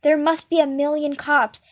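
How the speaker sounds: ordinary delivery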